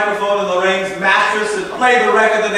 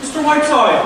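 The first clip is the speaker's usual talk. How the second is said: high raised call across the room; 8 semitones higher than usual. Acted. Calling Mister Whiteside from across the room, raised call.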